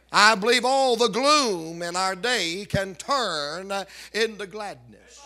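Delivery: rousing Southern preacher climbing in force; preaching. Acted. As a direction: build the sermon with fire. Preacher building to full force.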